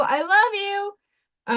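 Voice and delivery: high, slow cooing puppy voice; voicing the puppy, high and drawn out; puppy's voice. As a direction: coo it like a lovestruck puppy. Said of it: "and he's like".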